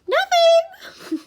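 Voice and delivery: loud, very high falsetto. A narrator's reading. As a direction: pitch it far up in a loud falsetto. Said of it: "Grover said in his falsetto voice".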